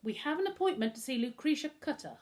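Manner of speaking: shouted. A narrator's reading. Visitor announces appointment at the intercom, mildly shouted. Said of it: "Humphrey shouted".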